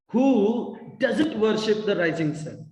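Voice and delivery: drawn-out "Who", then stressed "doesn't"; slow down and lean on "doesn't"; deliberate question, weight on "doesn't", falling end; emphatic question. Shown as a demonstration. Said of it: "You need to put emphasis on the question".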